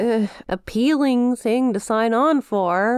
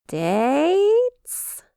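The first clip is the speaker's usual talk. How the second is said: incredulous; 7 semitones higher than usual. Acted. Demon incredulous at date proposal.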